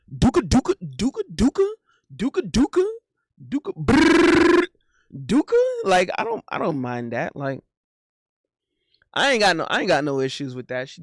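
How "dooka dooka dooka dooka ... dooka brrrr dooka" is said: bouncy "dooka" jabs with a rising lilt; playful boxing sound effects; mimicked punch flurry. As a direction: punch noises bursting into a high, loud trilled "brrrr".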